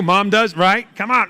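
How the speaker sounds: normal talking voice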